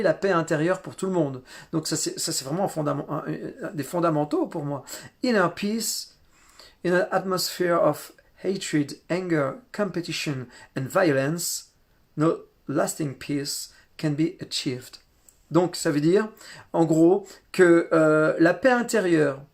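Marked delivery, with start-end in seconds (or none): 5.2-15.0 slow, careful English read with a French accent. Real, not acted. Reading a lama's quote to the group, slow French-accented English. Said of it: "Excusez mon accent".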